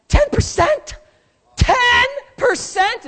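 high, loud disbelieving shout; incredulous outburst. Retold or imitated. God shouting in disbelief at stingy givers.

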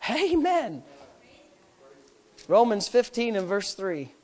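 usual speaking voice

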